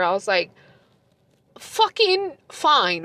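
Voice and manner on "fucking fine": exasperated concession. Retold or imitated. Grudging reply to her ex.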